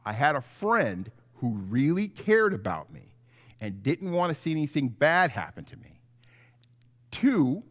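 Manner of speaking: normal talking voice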